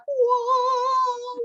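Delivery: long, high heavenly swell; mock awe. Showing how the trunk light shone, mock awe. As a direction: hold it high like light pouring out.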